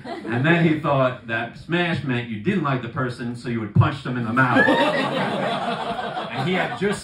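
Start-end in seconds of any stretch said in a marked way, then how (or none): none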